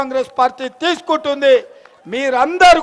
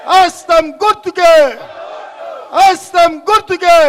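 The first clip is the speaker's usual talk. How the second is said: rally slogan. Real, not acted. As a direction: shout it as a campaign cry. Rally slogan for the party symbol. (హస్తం గుర్తుకే అని గట్టిగా నినాదం)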